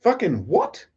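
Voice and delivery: high-pitched baffled disbelief; incredulous. Retold or imitated. Streamer replaying his reply to the man doubting his accent, incredulous. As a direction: recoil in high-voiced disbelief. Reacting to stranger's accusation.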